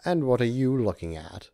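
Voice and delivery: disdainful challenge; contemptuous. A narrator's reading. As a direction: let contempt ooze from every syllable. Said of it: "contempt ... oozed from every syllable".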